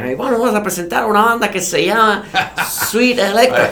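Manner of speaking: put-on high emcee in a Caribbean Spanish accent. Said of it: "cada vez que tú haces una, un acento caribeño".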